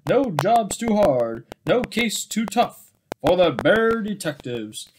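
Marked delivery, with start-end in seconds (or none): none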